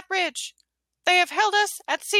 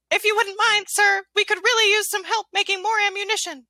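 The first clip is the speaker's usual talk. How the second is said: high squeaky cartoon mouse; Mickey Mouse voice; 5 semitones higher than usual. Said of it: "he became Mickey Mouse".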